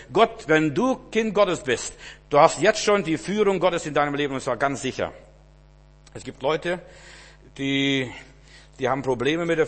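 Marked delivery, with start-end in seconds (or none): 0.1-5.1 higher, louder, full-force sermon voice; fervent preaching. Acted. Preacher telling David's story, loud fervent preaching. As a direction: preach it out with fire.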